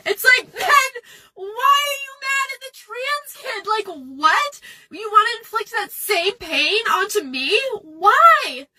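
high, cracking, tearful cry-shout; sobbing protest; crying outburst. Real, not acted. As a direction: burst out through tears, pleading. Upset outburst while crying.

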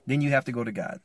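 ordinary delivery

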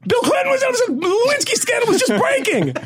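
high, laughing, incredulous; laughing disbelief. Real, not acted. Telling friends about the absurd old ticket, laughing high. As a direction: crack up in disbelief, pitched high.

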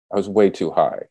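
ordinary talk

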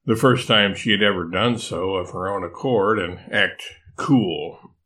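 ordinary delivery

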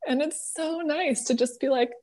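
ordinary talk, nothing marked

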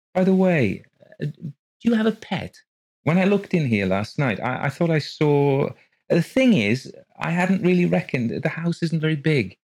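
high, hesitant, fumbling question. Acted. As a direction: ask awkwardly, tripping over words. Rose awkwardly asking.

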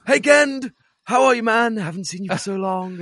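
high, loud delighted hello; a hammed-up thrilled Bill Nighy; excited greeting. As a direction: greet an old friend with glee. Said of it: "He's like".

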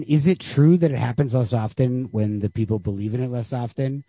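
usual speaking voice

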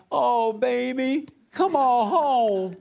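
doting mama. Retold or imitated. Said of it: "Mama would have said".

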